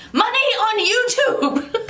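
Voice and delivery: retold scream. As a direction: laugh while chanting it out the window. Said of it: "And I screamed".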